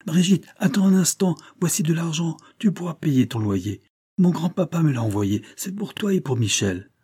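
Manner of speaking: high, breathless rush of good news; excited boy. A narrator's reading. A boy bringing money to the poor woman, excited. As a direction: burst in with the gift. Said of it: "s'écrier en se précipitant".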